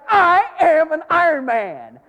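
high, slow, proud proclamation; triumphant boast. Retold or imitated. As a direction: crow it at the finish line. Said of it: "and I'm like".

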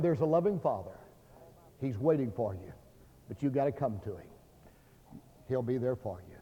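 soft, low, unhurried pleading; hushed, reassuring preacher's invitation; tender appeal. Real, not acted. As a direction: gently coax the listener with pauses between phrases.

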